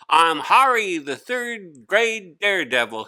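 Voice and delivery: put-on boy on a chair declaring himself the class daredevil; showman daredevil. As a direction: announce yourself like a stunt showman. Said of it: "he repeated".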